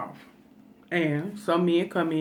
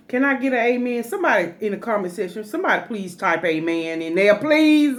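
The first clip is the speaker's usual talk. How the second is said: church call-out; 4 semitones higher, 5 dB louder than usual. Real, not acted. Calling out to viewers.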